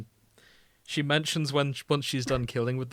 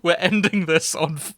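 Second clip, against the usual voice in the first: laughing; 5 semitones higher, 11 dB louder than usual. Real, not acted. Laughing at ending on Wikipedia.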